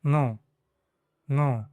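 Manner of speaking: नो with a slightly nasal o; नो with a light nasal tone; nasal Spanish no. Shown as a demonstration. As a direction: let नो ring a little through the nose. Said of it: "थोड़ा नाक से निकालना होता है".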